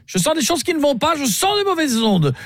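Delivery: hammed-up snooty client lecturing the hotel clerk; mock-offended caller. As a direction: scold him like a snooty know-it-all.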